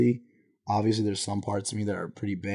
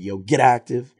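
punchy, louder hype-up call; imitated yell; 3 semitones higher, 7 dB louder than usual. Showing how people yelled in the Bay, imitated yell. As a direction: yell it like rallying friends, briefly imitated. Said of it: "common to just yell at people".

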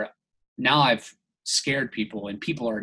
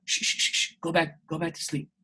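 soft, near-whispered shushing, coaxing him back down; hushing shush; 3 semitones higher, 6 dB quieter than usual. Retold or imitated. Groundskeeper hushing the waking man back to sleep, soft. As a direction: hush him like a soothing caretaker.

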